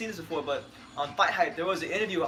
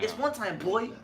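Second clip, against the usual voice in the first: higher lisping fighter voice; put-on lisp, pitched up; Keith Thurman; 5 semitones higher, slower than usual. Host closes the boxer taunt, high lisping catchphrase. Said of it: "he has a list for Keith Thurman".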